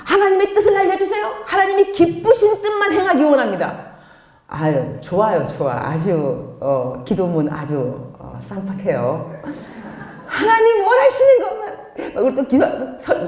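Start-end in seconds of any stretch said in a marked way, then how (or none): 0.1-3.9 high-pitched fervent praying voice; put-on pious plea, pitched way up; mock earnest prayer. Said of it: "우리 때 기도할 때 이러죠". 10.3-11.8 high-pitched devout pleading; exaggerated high prayer; mock pious prayer. Acts out an over-devout prayer.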